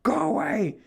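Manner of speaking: high, urgent shout; shooing the kids. Retold or imitated. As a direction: hurry them off, flustered.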